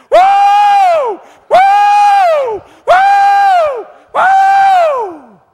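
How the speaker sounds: very high, very loud joyous whooping; joyful whoops. Retold or imitated. Mother hearing her son is saved, ecstatic high whoops. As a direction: whoop for joy at the top of your voice. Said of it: "you could hear that old mama saying".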